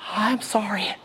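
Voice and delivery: whispered mock-contrite apology; meek apology. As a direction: whisper it like a cowed husband. Cowed husband answering angry wife.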